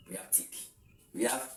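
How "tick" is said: "tick" set apart as one syllable; very soft "tick" on its own; isolated syllable. Shown as a demonstration. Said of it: "we have three syllables here".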